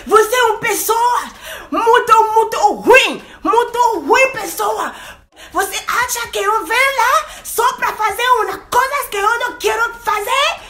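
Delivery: high, loud furious shout; shrieking rage; losing it. Retold or imitated. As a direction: scream insults at him, stretching the words. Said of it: "péter les plombs".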